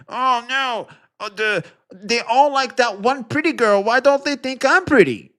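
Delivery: high, whiny mock teen girl voice; mock jealous teen girl. Mocking a streamer as a jealous teenage girl, high and whiny. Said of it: "sixteen-year-old girl".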